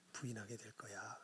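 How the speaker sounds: fading into a whisper; hushed warning. Acted. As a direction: whisper the prophecy softly. Jesus foretelling the denial.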